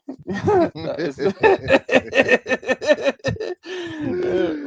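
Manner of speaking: long, high-pitched laughing fit; hearty laughter. Real, not acted. Cracking up at a viewer's joke, long high laughter.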